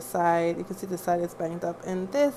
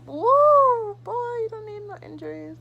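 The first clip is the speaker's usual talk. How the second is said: funny voice; 12 semitones higher, 7 dB louder than usual. Acted. Exclaiming in a put-on voice.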